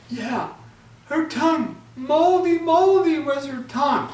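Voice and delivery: high, loud singsong mockery; drowsy taunt. Hauti mocks the ogress in his sleep, high singsong. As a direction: repeat the jeer high and loud.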